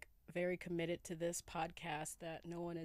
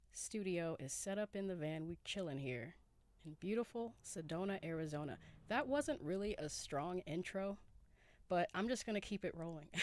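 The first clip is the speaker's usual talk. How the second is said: ordinary talk, nothing marked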